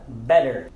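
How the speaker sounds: "better" with a quick D sound in place of the double T; American flapped T. Shown as a demonstration. English teacher modelling "better" for learners, T said as D. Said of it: "Essa é a melhor maneira de dizer".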